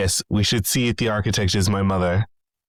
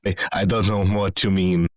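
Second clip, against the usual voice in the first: usual speaking voice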